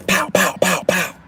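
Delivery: high, punchy sound-effect pows; imitated pows. Storyteller imitating a gator fight, high punchy pows. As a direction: fire off a string of high pows.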